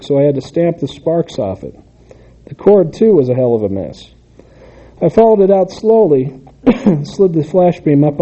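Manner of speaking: animated reading with lifted pitch on dialogue; story narration. A narrator's reading. Author reads a story about a neighbor to an audience, lively narration. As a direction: read a wry first-person tale aloud.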